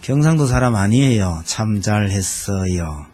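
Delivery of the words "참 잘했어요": sarcastic praise. Pretend. Mock praise for the husband.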